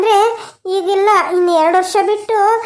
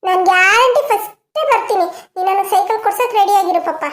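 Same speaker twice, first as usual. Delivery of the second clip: put-on higher, hopeful childlike voice; eager little boy; 4 semitones higher than usual. Grandson checking his father's promise, eager. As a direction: brighten up like a kid promised a gift. Adult voicing happy grandson.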